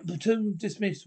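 cough, then bark it out across the parade ground; cough then loud dismissal. Acted. Sergeant dismissing platoon after a cough.

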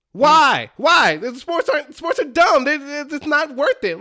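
real rant reaching a peak; exasperated outburst. Real, not acted. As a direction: vent at the fans in disbelief. Ranting about fans' death threats.

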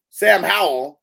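loud, high announcer call; hype announcer shout. Shown as a demonstration. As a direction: belt the name like a ring announcer. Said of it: "wait, hold on".

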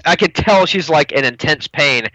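usual speaking voice

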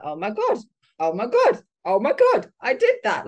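retold giddy disbelief; thrilled oh my God. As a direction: gush like you can't believe it. Retelling thrilled reaction at Arnold's.

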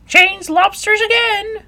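very high, loud Griffin yell; yelling at full voice. A narrator's reading. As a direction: yell at the top of your voice. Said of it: "yelled the Griffin at the top of its voice".

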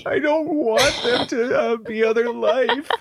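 put-on high, slow wailing child; hammed-up tearful whine; mock bawling. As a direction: imitate a kid crying in protest. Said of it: "and he's bawling".

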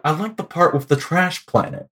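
quirky mock movie-character voice; Grandmaster. Eccentric ruler reviewing the movie he appears in, quiet and offhand. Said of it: "like, an Owen Wilson impression".

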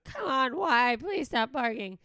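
half-shouted plea at a barking dog; pleading yell at dog. Retold or imitated. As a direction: owner yelling at her barking dog to please stop. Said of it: "screaming at my dog and going".

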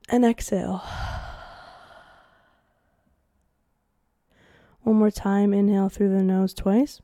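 calm counted breathing cues; breathing exercise guide. Acted. Host leading listeners through breathing, calm cues. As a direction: guide the inhale, hold and exhale.

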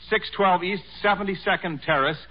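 usual speaking voice

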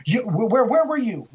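high, stammering burst of alarm; snapped, agitated. Retold or imitated. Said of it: "he just snapped".

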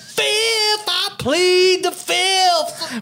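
squeaky high-pitched cry of filth, slow and drawn out; mock courtroom plea in a strained high voice; high mock falsetto. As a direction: screech it like a cornered witness. Chappelle skit impression, high falsetto.

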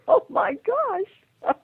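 very high, loud, stretched-out cry; shocked shriek. Retold or imitated. Seeing her ex-husband's cousin at the door, shrieking in shock. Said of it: "I'm going".

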